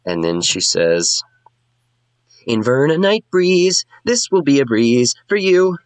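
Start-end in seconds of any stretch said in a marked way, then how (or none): 2.5-5.8 high sing-song bard. Acted. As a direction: lay the flattery on thick.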